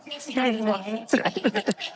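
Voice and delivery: big real laugh breaking out; laughing hard with his teammates. Real, not acted. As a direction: crack up at the long-haul driver joke. Cracks up at the joke.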